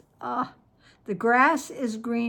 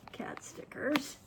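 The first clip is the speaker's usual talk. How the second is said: breathless whisper, slowed; 2 semitones higher, 9 dB quieter than usual. Real, not acted. Whispering to viewers over the adorable cat stickers. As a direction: hush it like a precious secret.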